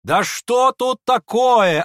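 high, loud, drawn-out demand; exasperated high-pitched bystanders' question; clamouring crowd. A narrator's reading. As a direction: demand it loudly, stretching the words. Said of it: "осведомлялись остальные".

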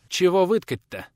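put-on high, brisk-minded young lad; businesslike boy. A narrator's reading. Boy asks the man about the order, businesslike. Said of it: "деловито поинтересовался пацан".

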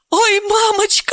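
high moan of a frightened woman; whimpering. Acted. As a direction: whine it out in dismay. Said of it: "охнула и заскулила".

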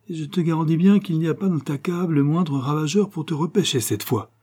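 high-pitched, mildly mocking menace; cynical. A narrator's reading. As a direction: taunt the victim with cool cynicism. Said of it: "d'un ton cynique". (d'un ton cynique, légèrement moqueur)

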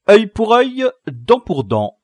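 loud, high moralist's pronouncement; sententious proclamation. Acted. Little moralist justifying revenge, loudly sententious. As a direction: proclaim it like a solemn verdict. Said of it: "proféra le moraliste Lacrique".

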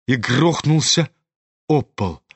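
ordinary delivery, nothing marked

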